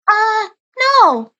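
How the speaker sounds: put-on high young female rabbit voice correcting him; correcting as the sister. Sister corrects Nick's mistake, high light voice. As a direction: set him straight, lightly. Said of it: "the silhouette corrected".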